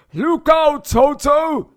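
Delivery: loud, high warning shout. A narrator's reading. Hippo shouting a warning to Toto. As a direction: yell the warning across the jungle. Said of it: "shouted Humpy Rumpy".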